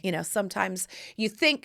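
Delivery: normal talking voice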